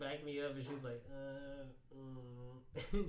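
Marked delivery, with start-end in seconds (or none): 1.1-3.1 imitating hesitant, drawn-out filler sounds; mock confusion. Imitating her on the other line, confused. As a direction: stall as if unsure. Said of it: "like, confused in a way".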